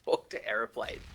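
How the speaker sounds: breathy mocking parrot of his words; teasing whispered repeat of a friend's boast; mocking echo. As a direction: echo him back as a whispered taunt. Teammate mocks his job line.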